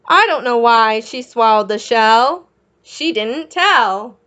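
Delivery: lively sing-song storybook read; reading to children. A narrator's reading. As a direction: read the rhyme out to little kids. Reading picture book to children.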